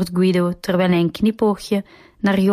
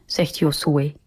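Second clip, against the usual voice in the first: usual speaking voice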